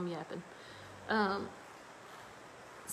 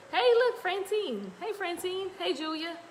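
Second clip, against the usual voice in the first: calling out greetings; 10 semitones higher, 4 dB louder than usual. Real, not acted. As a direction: sing out names to friends arriving. Greeting arriving viewers by name.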